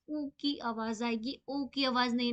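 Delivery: usual speaking voice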